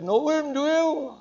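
high-pitched little lady challenging a man; fed-up challenge. Retold or imitated. Little lady confronts blasphemer on the bus, high-pitched challenge. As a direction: pitch it right up, a pointed question. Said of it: "she'd had enough".

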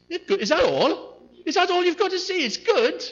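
hammed-up offended wife; wife, offended by faint praise, in mock outrage; indignant wife.

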